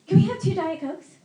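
lightly acted friend, high and loud; eager friend ordering. Friend to burger joint cashier, high acted order.

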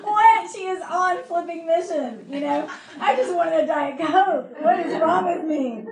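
high, loud, laughing disbelief; comic self-reproach pitched high; mock exasperation. Pastor outdone by bold friend, mock exasperated. Acts out own exasperated thoughts.

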